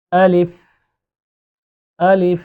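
আলিফ said short, with no vowel drawn out; short alif. Shown as a demonstration. Teacher showing students আলিফ read short, with no stretch. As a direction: clip আলিফ with no stretch at all. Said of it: "উচ্চারণ এইভাবে করতে হবে".

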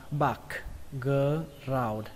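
BACKGROUND drawn out in separate slow parts; slow syllable-by-syllable. Shown as a demonstration. Teacher introducing a new word, slow broken-up syllables. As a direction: say "background" very slowly, part by part. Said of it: "các bạn đọc là".

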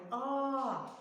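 «а» drawn out freely; open, unobstructed «а», held long; vowel demonstration. Shown as a demonstration. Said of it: "нам нічого не заважає його вимовляти". (протяжне, вільне «а»)

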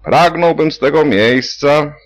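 high, loud official speech opening; patient slips into his speechifying; speech-making. A narrator's reading. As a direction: orate like at a party rally. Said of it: "tonację swoich rozlicznych przemówień".

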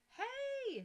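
a sudden high, loud yell; shouted hey. Acted. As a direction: shout to stop a thief. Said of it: "shouted the keeper".

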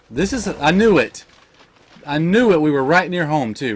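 loud, high frustrated cry; angry outburst. Real, not acted. Gamer fuming at another loss, loud. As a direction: erupt in annoyance. Pet dies again.

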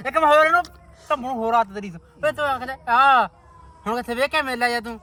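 loud, rowdy ribbing; loud teasing banter. Real, not acted. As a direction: shout the teasing at your mate. Loud teasing banter among friends.

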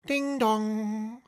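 high ringing ding dong like a clock bell; bell chime. A narrator's reading. Narrator imitating the clock bell, high ringing chime.

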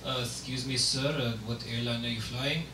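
low, soft-spoken driver; hushed, polite shuttle driver; airport shuttle driver. Acted. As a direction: ask meekly, low and quiet. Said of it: "telling an airport shuttle driver".

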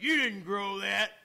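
loud, slow disbelieving retort; a hint of a doubting neighbor. Neighbor Garden doubting the turnips, loud disbelief. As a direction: call his bluff, loud.

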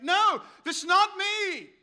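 loud, high, drawn-out denial; emphatic denial. Acted. Peter denies being with the Nazarene, loud emphatic denial. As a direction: push the denial out loud and slow.

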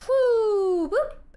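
high rising woo, slow falling whoop; warbling teleport zap with the mouth; teleportation noise. Pretend. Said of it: "Nice teleportation noise".

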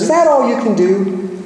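high-pitched parrot squawking out a taunt. Retold or imitated. Parrot heckles the plumber at work, high parrot voice. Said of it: "and the parrot says".

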